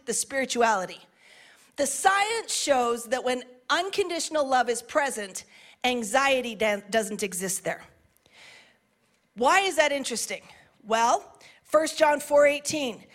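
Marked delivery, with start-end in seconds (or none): none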